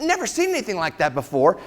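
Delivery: questioning echo with rising end; imitating a taken-aback patient; incredulous echo. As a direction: repeat it back in mock disbelief. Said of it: "I said".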